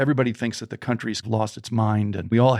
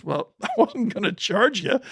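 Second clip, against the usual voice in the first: amused cowboy; 10 semitones higher than usual. Retold or imitated. Cowboy refuses payment, laughing.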